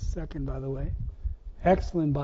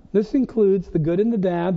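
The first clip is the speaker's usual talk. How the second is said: ordinary talk, nothing marked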